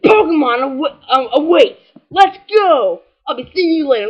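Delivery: loud mock-yelled game text; reading it as yelling. Reading game text aloud as if it yells at the player. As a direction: shout the game's line like it's yelling at you. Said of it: "it's like they're, like, yelling at you".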